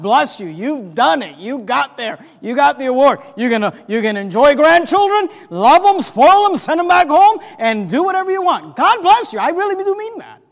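loud, rising pulpit cheer; high, emphatic sermon delivery; rousing preaching. Acted. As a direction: send them off with gusto. Preaches with full force.

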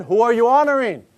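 ordinary delivery

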